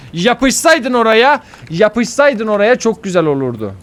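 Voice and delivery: high, loud lament; loud, aggrieved outburst; exasperated complaint. Real, not acted. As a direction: yell it in frustration. Laments a missed grab.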